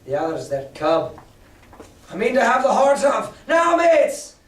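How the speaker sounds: rallying cry. Acted. Mary urging the pirates to attack, building to a shout. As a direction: whip the crew up to charge. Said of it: "raising his arm and his voice".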